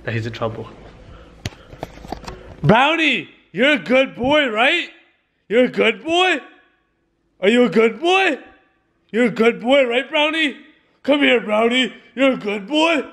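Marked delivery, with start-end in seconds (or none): 2.6-13.1 kind words in a high, raised scolding voice; mock yelling-at tone. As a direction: pretend to tell the dog off. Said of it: "they're getting yelled at".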